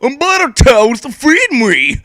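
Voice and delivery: mock baby voice. Friend reading fanfic aloud, voicing a whiny baby. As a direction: ham up a demanding baby.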